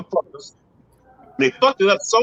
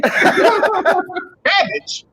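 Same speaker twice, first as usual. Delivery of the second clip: high laughing cabbage in disbelief; amazed, laughing repeat; incredulous laugh; 12 semitones higher than usual. Retold or imitated. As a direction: laugh it out, can't believe it. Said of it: "I said".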